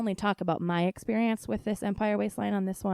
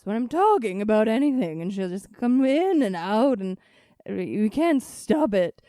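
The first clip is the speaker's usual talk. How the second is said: stretched, projected vowels creeping into normal talk; Julia Child; 3 semitones higher, 6 dB louder than usual. Shown as a demonstration. Host showing how the Julia Child voice takes over mid-sentence. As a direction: slide into a loud, swooping TV chef voice. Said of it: "Julia Child comes out a lot in my voice".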